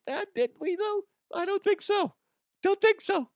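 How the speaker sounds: very high falsetto reply; put-on tiny high voice; answering character. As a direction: squeak back a doubtful denial. Acts out a voice answering back.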